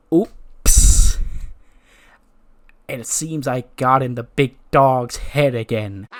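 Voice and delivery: loud oops, then slow smug drawl; gloating taunt. Acted. Seth rubs it in to Roman, slow and gloating. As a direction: savor the mischief, drawing it out.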